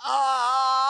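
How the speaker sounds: high howling cry; howl of terror. Acted. Warlock howling in the serpent's poisonous breath. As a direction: howl as the fumes hit. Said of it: "howled Nathaniel".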